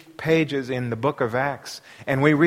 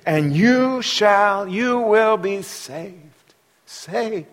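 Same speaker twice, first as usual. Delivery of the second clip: slow emphasis; 4 semitones higher, much slower than usual. Acted. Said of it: "preacher dwells on key words".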